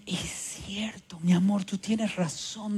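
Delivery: soft hushed whisper, giving in; whispered concession. Retold or imitated. Wife quietly admitting her son is right, in a whisper.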